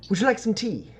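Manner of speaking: high-pitched host offering tea; character's polite offer. Acted. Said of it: "And he goes".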